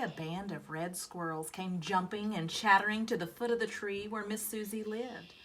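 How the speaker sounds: ordinary delivery, nothing marked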